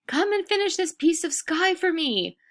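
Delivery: high, pleading call across the house. A narrator's reading. Adis begging her husband to finish the sky, high calling. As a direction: call him over, desperate. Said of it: "calling her husband".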